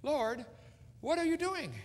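high, pleading wail upward; a high, drawn plea to the Lord; anguished cry. Acted. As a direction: cry out to God in despair. Preacher's own cry to God.

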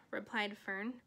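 ordinary delivery

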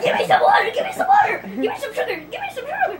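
imitating a desperate plea while laughing; laughing, high mock plea for water; mock pleading.

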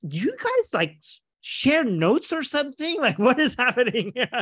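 high, bewildered mock question to both camps; mock baffled quote. Activist asking two religious camps, in high mock disbelief. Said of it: "I'm like".

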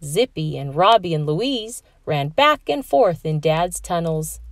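usual speaking voice

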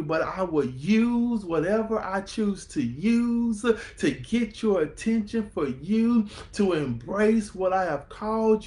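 loud, high, climbing sermon peak; preaching at full force. Acted. Preacher voicing God's promise to the congregation, loud and high. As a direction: lift the house with God's promise.

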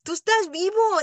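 loud, high, pleading through tears; impassioned tearful plea; tearful prayer. Retold or imitated. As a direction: cry out to God as if weeping. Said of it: "yo estaba llorando".

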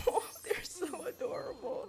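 high squeal of adoration; gushing. Real, not acted. Watching her adorable Sims couple, high gushing. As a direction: gush over a cute couple.

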